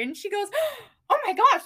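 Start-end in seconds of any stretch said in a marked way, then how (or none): 0.8-1.6 mock gasp, then a high, loud oh my gosh; imitating mom's gasp. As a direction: play mom, shocked at the haircut. Mom reacting to haircut.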